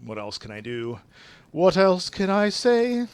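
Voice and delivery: normal talking voice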